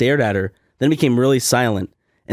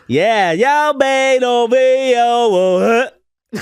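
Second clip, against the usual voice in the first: high, slow wailing nonsense; imitated garbled outburst; 8 semitones higher, 4 dB louder, much slower than usual. Woman expecting him to finish, high mock wail. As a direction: howl out slurred syllables, stretched long.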